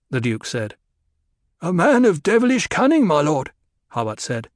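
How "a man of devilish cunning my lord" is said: high, loud warning about an enemy; a wary Mentat's raised warning; grim old Mentat. A narrator's reading. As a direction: press the words hard in a higher voice. Hawat voiced by reader.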